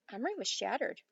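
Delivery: usual speaking voice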